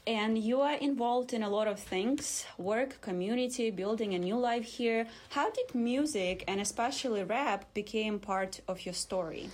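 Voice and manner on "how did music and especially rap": usual speaking voice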